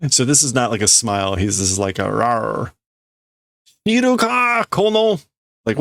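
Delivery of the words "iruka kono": high, rough complaint; growing disgust. Acted. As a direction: let the disgust boil over. Workers jeer at the leader.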